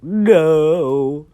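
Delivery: long, high, loud wail of no; dramatic drawn-out yell; mass protest. Acted. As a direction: cry out in hammed-up dismay. Whole class protesting the announcement.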